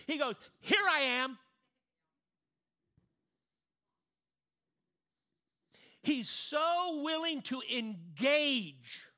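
loud, high, slow preaching; full-force preaching. Acted. Preacher urging the congregation about God's love, loud and slow. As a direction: preach it big and slow, pressing it home.

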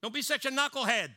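high, loud rebuke from above; booming voice of God. Retold or imitated. The heavenly voice scolding Peter, loud rebuke. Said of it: "And the voice said".

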